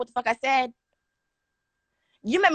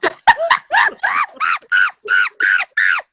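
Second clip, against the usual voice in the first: high screaming laugh fit; loud shrieking laugh; 12 dB louder than usual. Real, not acted. After her phone-throwing story, a loud shrieking laugh. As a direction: howl with laughter.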